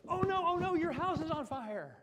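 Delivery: very high, loud, alarmed wail; mock a fireman losing his head; hammed-up panic from a reactive fireman; panicky fireman. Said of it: "That's reactivity".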